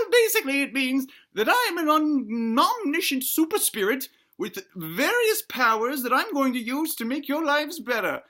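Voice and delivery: high-pitched granny falsetto; granny ghost. Acted. Granny ghost promising to better family's lives, high granny voice. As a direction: explain grandly as an old granny spirit. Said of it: "what does that mean".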